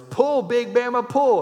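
high, loud command to a horse; big rousing call, pitched high; farmer's yell. Acted. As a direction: holler slowly to drive the horse on. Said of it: "he yells".

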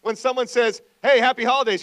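rally the church, raise the voice; full-force preaching. Acted.

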